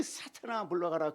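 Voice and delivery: 사탄아 said gently, not shouted; tender rebuke with love; loving rebuke. Acted. (사랑을 담아 부드럽게 꾸짖기)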